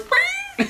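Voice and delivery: very high, loud mock squeal; high-pitched squeal. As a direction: let out a panicked little "wee". Imitated inner squeal at crush's question.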